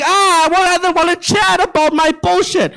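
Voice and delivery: a hint of a bored chatterbox; mock whiny bowler. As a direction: whine like someone who does not care.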